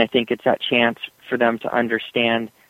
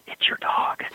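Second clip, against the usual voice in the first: whispered aside; 6 dB quieter than usual. Real, not acted. Confiding punchline.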